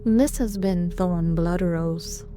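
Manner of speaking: ordinary delivery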